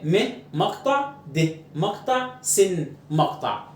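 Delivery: slow syllable-by-syllable breakdown of medicine; teacher sounding out each syllable slowly; split into syllables. Shown as a demonstration. Teacher breaks medicine into syllables for students, slowly. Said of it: "medicine، three syllables".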